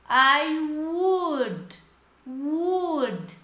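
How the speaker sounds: "would" with pursed, rounded lips. Shown as a demonstration. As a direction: push the lips forward on "would". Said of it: "faz biquinho". ("would" fazendo biquinho)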